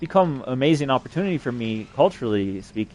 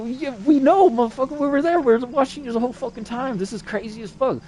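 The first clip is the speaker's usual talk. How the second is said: retold worked-up voices; 11 semitones higher than usual. Voices answer him excitedly in story.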